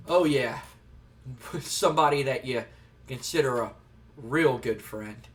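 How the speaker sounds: low, knowing aside, slowing at the end; sly gloating. Retold or imitated. Boss alone after wrestler runs out, slyly gloating.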